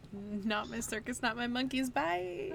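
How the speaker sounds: singsong climbing to a high bye; airy dismissive sign-off, pitch lifting; breezy washing of hands. Acted. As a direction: chirp it off, rising step by step. Voicing mouse abandoning the message.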